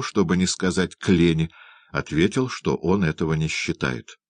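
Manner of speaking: plain reading voice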